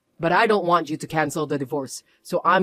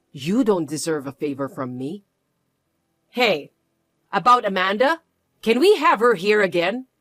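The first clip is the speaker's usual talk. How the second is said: ordinary delivery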